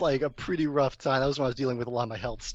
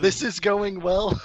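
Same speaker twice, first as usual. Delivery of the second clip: sarcastic; 7 semitones higher, 6 dB louder, slower than usual. Real, not acted. Audio keeps cutting out.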